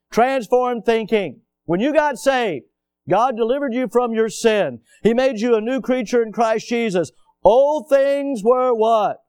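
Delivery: loud, high preaching build; loud preaching, slowing on the question; full-force preaching. Acted. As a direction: preach it out with fire.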